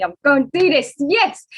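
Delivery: put-on a young drama fan's loud excited vow. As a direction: cheer yourself on with a triumphant yes. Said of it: "I was like".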